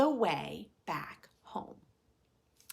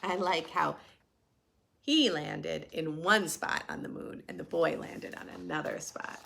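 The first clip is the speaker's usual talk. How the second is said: ordinary delivery, nothing marked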